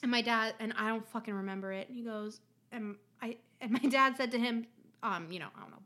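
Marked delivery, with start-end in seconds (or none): none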